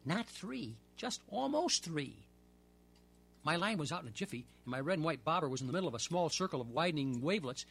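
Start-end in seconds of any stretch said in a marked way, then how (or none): 0.1-2.2 slow, higher, stretching the words; drawn-out excuse. Acted. Portry to the narrator, sheepishly stretching the words. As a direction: spin it out like a sheepish fib.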